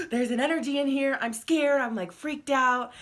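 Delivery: retold fright. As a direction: replay the panic of that night, half amused. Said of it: "I'm freaked out".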